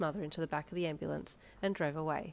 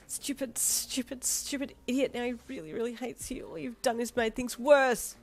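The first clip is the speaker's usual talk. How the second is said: screaming at herself; 6 semitones higher, 4 dB louder than usual. A narrator's reading. Alone after running out of hospital, high angry self-scolding. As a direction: curse yourself for ruining it. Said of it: "you screamed at yourself".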